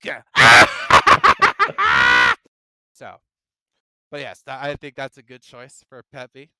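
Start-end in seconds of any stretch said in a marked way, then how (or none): 0.3-2.4 manic laugh. Acted. Joker laugh after joke.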